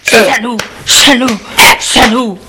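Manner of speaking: high, anguished repeated insult; pain and rage. Acted. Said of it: "plein de douleur et de rage".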